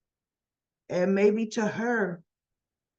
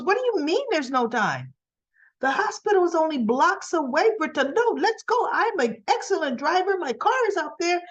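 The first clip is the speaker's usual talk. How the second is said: frantic, pitched much higher; urgent mother; 8 semitones higher than usual. Retold or imitated. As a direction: rush her toward the car in a panic. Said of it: "She goes".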